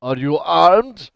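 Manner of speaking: demanding call from outside; loud, slow challenge. Acted. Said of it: "the person says".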